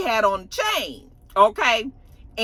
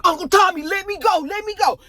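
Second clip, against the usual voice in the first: put-on whiny pleading kid; 9 semitones higher than usual. Nephew pleading with uncle.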